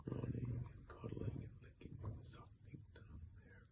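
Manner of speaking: soft unvoiced whisper; whispered aside. Real, not acted. Reader whispering over the picture to the child.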